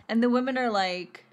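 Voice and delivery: ordinary delivery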